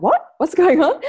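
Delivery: bewildered, catching up to what just happened; confused shock. Retold or imitated. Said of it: "you have that moment where you're like".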